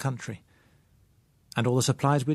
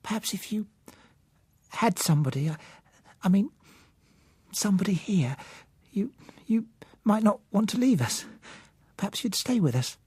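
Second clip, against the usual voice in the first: put-on higher girl's voice, halting and half-whispered; shy young girl, faltering; 8 semitones higher than usual. A narrator's reading. Blind girl asking the narrator to stay, faltering whisper. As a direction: stumble shyly through the offer.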